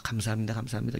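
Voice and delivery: soft, held-down repeated thanks; forced thanks. Retold or imitated. Forcing oneself to give thanks despite anger, quiet restraint. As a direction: murmur thanks while pressing feelings down. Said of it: "이렇게 누르면서".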